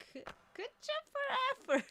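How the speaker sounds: high-pitched put-on congratulating voice; mock praise. Imagined viewer praising the effort, high mock voice.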